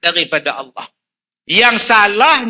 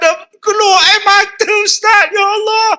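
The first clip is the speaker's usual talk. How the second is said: excited cry; 14 semitones higher than usual. Acted. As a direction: cry out in joyful amazement. Preacher acting a weeping woman. (seruan gembira yang lantang)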